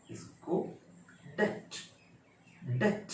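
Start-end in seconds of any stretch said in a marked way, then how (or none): 1.3-3.1 डेट as one syllable "det"; silent b. Shown as a demonstration. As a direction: model "det" with no b at all. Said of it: "बी silent है". (डेट, "ब" बिना बोले)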